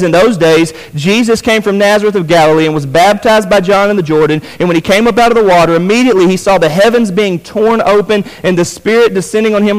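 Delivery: high, projected pulpit reading; energetic scripture reading. Real, not acted. Preacher reading the baptism account to the congregation, energetic. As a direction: read scripture out with preacher's drive.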